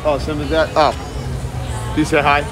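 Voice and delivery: usual speaking voice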